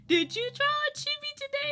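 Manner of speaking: sing-song husband. Retold or imitated. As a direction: coax her like a playful husband, up high. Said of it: "My husband, he's been, like, reminding me".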